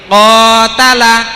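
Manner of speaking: "qatala" drawn out loud, with the ka held long; long vowel demo. Shown as a demonstration. Teacher quizzes pupils on the long vowel in qatala. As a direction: stretch "qatala" so the long a is heard. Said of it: "saya ulangi lagi".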